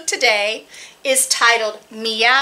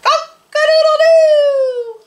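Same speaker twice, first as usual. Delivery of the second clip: rooster crow; 7 dB louder than usual. Acted. Cat crowing at dawn in place of the rooster, very high and loud. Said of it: "opens his mouth and throws back his head".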